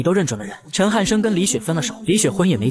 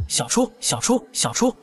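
understated urgent call, pitched up; 6 semitones higher, slower than usual. A narrator's reading. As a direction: call out the name urgently without raising volume. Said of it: "在窗口叫我的名字".

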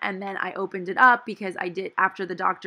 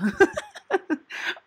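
laugh; 12 semitones higher than usual. Real, not acted. Laugh after joke.

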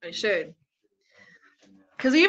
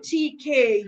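ordinary talk, nothing marked

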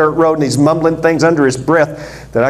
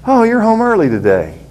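cheerful wife; 7 semitones higher than usual. Acted. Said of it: "She hears her husband".

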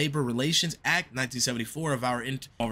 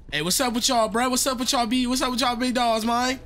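high, loud hype call to the crew; loud greeting; 10 semitones higher, 4 dB louder than usual. Pretend. Gamer calling out to the rig workers in a loud greeting. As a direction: shout hello across the room like a hype man.

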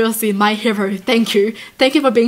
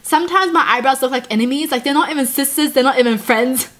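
ordinary talk, nothing marked

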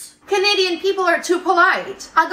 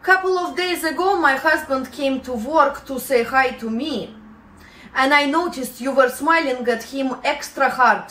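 low, measured Russian boss; cold, deliberate firing in a low voice; 4 semitones lower than usual. Acted. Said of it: "how they lay you off in Russia".

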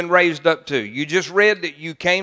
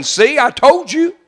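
slow, gloating, pitched up high; mock I-told-you-so; 9 semitones higher, 6 dB louder, slower than usual. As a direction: rub it in slowly, smug. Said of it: "and you're saying".

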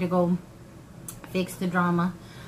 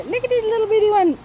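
excited; 12 semitones higher, 6 dB louder, faster than usual. Real, not acted. Showing tiny potato.

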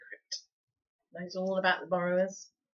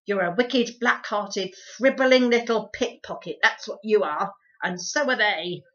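louder, spitting out each insult; angry tirade; 3 semitones higher, 9 dB louder than usual. A narrator's reading. Housekeeper berating the boy and the borrowers, angry. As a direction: heap scorn on him word by word.

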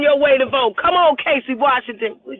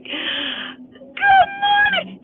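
much higher, very slow singsong; drawn-out greeting; much slower than usual. Real, not acted. Greeting Maya on the livestream, delighted drawn-out singsong. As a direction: stretch it out with delight.

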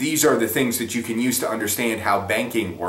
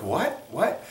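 confused what; 9 semitones higher, 3 dB quieter than usual. Retold or imitated. Bewildered listener reacting.

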